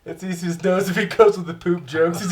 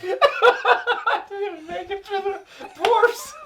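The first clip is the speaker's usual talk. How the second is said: slow, high words through laughter; can't get it out for laughing; 13 semitones higher, slower than usual. Real, not acted.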